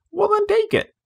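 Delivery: put-on very high childish taunt; squeaky little boy dare; child's taunt. Children bickering over medicine.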